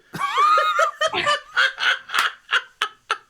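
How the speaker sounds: long, high, helpless laugh; loud laughter. Real, not acted. Cracking up at the absurd song, helpless laughter. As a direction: burst out in a high cackling laugh.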